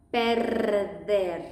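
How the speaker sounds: "Perder" with a long, strong rolled R; exaggerated rolled R. Shown as a demonstration. Teacher exaggerating the trill in "Perder" for learners. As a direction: roll the R in "Perder" hard and long. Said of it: "to exaggerate the R".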